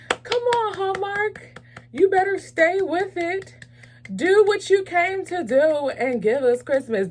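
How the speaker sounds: hoge, aanmoedigende uithalen; urging cheer. Pretend. Cheers Hallmark on directly.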